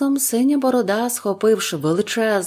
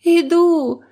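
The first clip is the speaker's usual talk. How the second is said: high, loud despairing cry; wife crying out in despair; desperate; 10 semitones higher, 7 dB louder than usual. A narrator's reading. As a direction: answer in despair. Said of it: "розпачливо сказала дружина".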